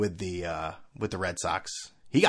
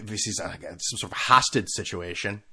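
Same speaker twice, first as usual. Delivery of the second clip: ordinary talk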